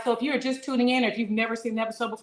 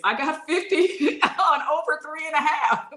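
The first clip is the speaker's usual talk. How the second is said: higher and louder, laughing through the words; laughing read; 9 semitones higher, 6 dB louder, slower than usual. Acted. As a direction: read it while cracking up. Reads a comment while laughing.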